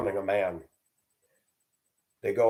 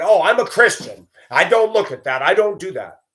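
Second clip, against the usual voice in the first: high, loud, prissy holier-than-thou voice; mock self-righteous believer, raised and loud; 7 semitones higher, 6 dB louder than usual. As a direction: sneer it like a smug churchgoer. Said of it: "Christians try to pretend".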